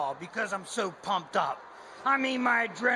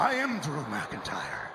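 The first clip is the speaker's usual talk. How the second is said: Scottish brogue; 9 semitones lower, 3 dB quieter, slower than usual. Acted. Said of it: "W W E Champion Drew McIntyre".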